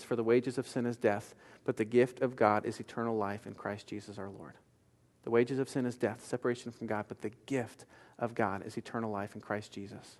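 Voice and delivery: soft, low, quiet reading of the verse; hushed scripture. Acted. Preacher quoting Romans to congregation, hushed. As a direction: read it barely above a murmur.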